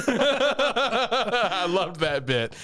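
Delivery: high, slow, drawn out through a laugh; giggly delight at the memory. Real, not acted. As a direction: stretch it out while still laughing. Laughing, recalling a funny scene.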